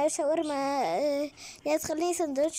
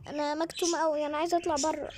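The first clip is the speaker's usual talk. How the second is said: ordinary delivery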